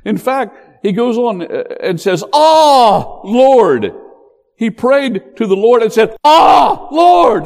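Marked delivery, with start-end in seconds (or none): 2.3-3.9 high, loud groaning appeal; loud, high exasperated "Ah, Lord"; frustrated cry. Acted. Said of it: "That's how he said it". 6.2-7.4 high, loud shouted "Ah, Lord"; loud exasperated cry to the Lord; frustrated shout. Acted. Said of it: "That's how he said it".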